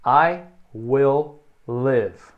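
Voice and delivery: "I will live" very slow, both i short; short i sounds, slow. Shown as a demonstration. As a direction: spell out each short i slowly. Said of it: "os dois I é bem curtinho".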